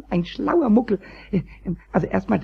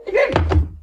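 high, loud, half-laughing protest; exasperated outburst at the phone; frantic shouting; 8 semitones higher, 4 dB louder than usual. Acted. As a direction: shout down the talking clock. Arguing with time announcement.

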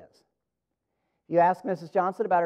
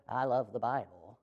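low, soft, throwaway delivery; cliché; 8 semitones lower, 11 dB quieter than usual. Shown as a demonstration. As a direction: toss it off as a stock phrase. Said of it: "It's not just a cliche".

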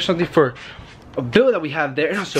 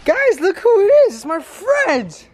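excited; 4 dB louder, slower than usual. Real, not acted. Greeting a friend on camera.

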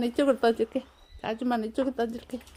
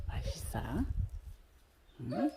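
soft whisper to a pet; 7 semitones lower, 7 dB quieter, much faster than usual. Real, not acted. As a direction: breathe it to the dog. Whisper to dog.

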